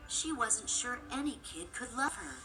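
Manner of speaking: plain reading voice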